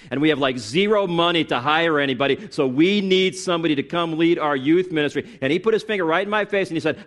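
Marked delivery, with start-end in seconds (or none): 0.1-5.2 retold pastor's pressing plea, pitch climbing; urgent appeal. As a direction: imitate a pastor urgently recruiting help.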